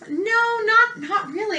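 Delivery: high, exaggerated no for the kids; playful no. Teacher answering her own question to the class, playfully.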